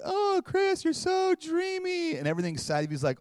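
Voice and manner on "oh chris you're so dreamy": very high swooning falsetto, slow; boy faking a dreamy girl on the phone; hammed-up high girly coo; fake girl voice. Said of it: "[name] pretending to be Susie".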